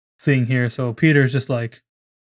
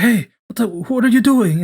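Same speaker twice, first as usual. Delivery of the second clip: startled call-out; 9 semitones higher, 3 dB louder than usual. Retold or imitated.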